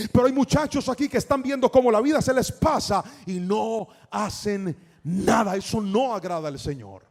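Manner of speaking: loud, relentless exhortation; preaching at full force. Real, not acted. Preacher rallying young people and parents, full-force preaching. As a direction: drive every phrase home at full volume.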